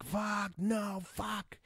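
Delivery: a pained, high-pitched "no" as it goes wrong; dismayed. Retold or imitated. Viewer watching zombies get in with the dog, high dismayed cry.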